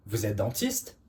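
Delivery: "Vous êtes dentiste?" with the pitch climbing sharply on "dentiste"; rising question intonation. Shown as a demonstration. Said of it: "increase the intonation at the end".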